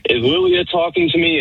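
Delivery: high falsetto imitation of the texter; lightly hammed-up high woman; mock woman's voice. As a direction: squeak it out like a confused woman. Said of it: "And she goes".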